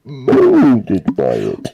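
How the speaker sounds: muffled Bane voice through a cup, slow and loud; talking into a glass. Retold or imitated. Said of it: "You just talk into a cup".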